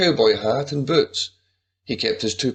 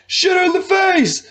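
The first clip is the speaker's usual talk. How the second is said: very high, loud yell; bro shout; 5 dB louder than usual. Acted. Buddy yelling at Juarez to shoot Kim, shrieking. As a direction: scream it with glee.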